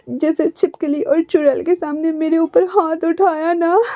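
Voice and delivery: high, whiny tearful demands. A narrator's reading. Wing demanding a public sorry, high and teary. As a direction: threaten to cry like a spoilt child. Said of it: "अपने आंसू को wipe भी कर रहा था".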